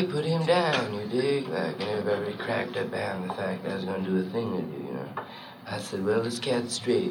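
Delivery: low, soft jive-talking cat; hipster jazz trumpeter. Acted. Hip trumpeter answers the bandleader, low and laid-back. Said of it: "the new trumpet player".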